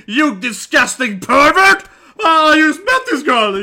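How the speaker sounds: loud playful scolding at the screen; mock outraged yell. Real, not acted. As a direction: call him out in loud fake horror.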